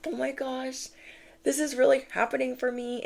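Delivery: high-pitched, mock-awed delight; amazed delight. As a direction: marvel in a high, hushed pretend voice. Acting listener's amazed, hopeful feeling.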